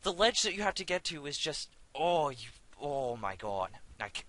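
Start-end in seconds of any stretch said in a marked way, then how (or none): 0.0-0.8 high-pitched exasperated rant. Real, not acted. Ranting after falling.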